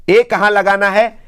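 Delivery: normal talking voice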